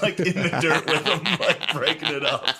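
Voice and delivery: cracking up at the memory; laughing. Real, not acted. Laughing at sister's dog fight.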